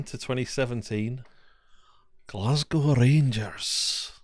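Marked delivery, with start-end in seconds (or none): 2.3-4.1 put-on slow, drawn-out Welsh accent. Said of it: "Can we do it without the Welsh accent?".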